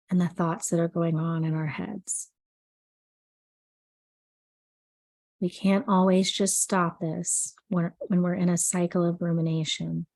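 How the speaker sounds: soothing sleep meditation read; guided meditation. Acted. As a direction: guide the listener gently into calm, unhurried. Leads an anxiety meditation.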